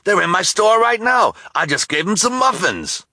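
high, loud eager rush; thrilled to have caught them; excited shopkeeper. Acted. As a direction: shopkeeper excited about the kids. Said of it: "the excited voice of the shopkeeper".

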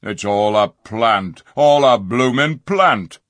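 gruff, fed-up dwarf; scornful dwarf. A narrator's reading. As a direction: sneer at all the talk. Griffle sneers.

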